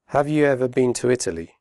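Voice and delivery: fast connected speech; quick natural question; quickly. Shown as a demonstration. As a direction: run the words together. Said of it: "first slowly and then quickly".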